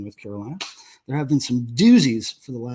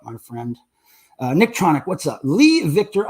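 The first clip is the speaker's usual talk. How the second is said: ordinary delivery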